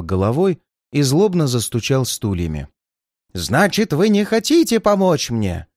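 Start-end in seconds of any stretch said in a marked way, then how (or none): 3.3-5.7 indignant challenge. Acted. Old man angrily challenges detective.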